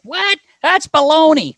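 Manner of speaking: high, hammed-up outraged teammate; imitating Phil. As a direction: squeak out an indignant protest. Phil's reaction, high mimicked voice.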